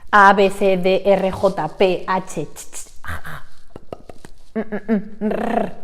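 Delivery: letters and sounds said one by one; sounding out each letter slowly; isolated Spanish sounds; Spanish sound demo. Shown as a demonstration. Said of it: "serie de sonidos".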